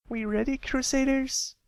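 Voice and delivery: very high proud call; rally the team with pride; rallying filly. Acted. Scootaloo rallying the Crusaders, very high and proud.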